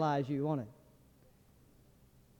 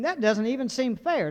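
ordinary talk, nothing marked

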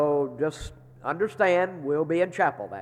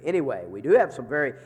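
ordinary talk, nothing marked

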